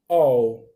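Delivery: teacher drilling "all"; pronunciation demo. Shown as a demonstration. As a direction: repeat "all" firmly, like I'll. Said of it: "eu posso falar all".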